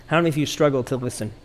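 quick, tossed-off asking; rushed question. Shown as a demonstration. Pastor first rushes the question to the congregation, fast. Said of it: "Maybe you didn't hear me".

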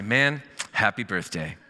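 slow, easy delivery at everyday pitch. Acted. Pastor voicing the reworded birthday card, slow and understated. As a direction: draw the greeting out without any shout. Sample card greetings differing in word flow.

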